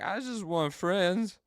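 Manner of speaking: high, slow pitiful whimper; mock-sad lonely angel; sad Barakiel. Barakiel longing for friends, high slow pitiful voice. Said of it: "Barakiel's just like".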